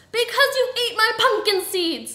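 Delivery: high, loud furious yell; full-volume outraged shriek; angry scream. A narrator's reading. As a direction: scream the accusation. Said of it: "screamed Miss Piggy".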